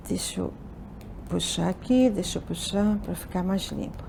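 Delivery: very soft, low aside; talking to oneself. Real, not acted. Teacher tidying up the screen, murmuring to oneself. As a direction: drop to a hushed self-directed murmur.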